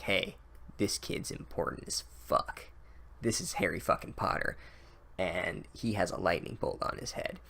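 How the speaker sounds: hushed conspiratorial whisper; mock stage whisper. The author nudging the reader, mock whisper. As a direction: whisper it like a secret tip-off.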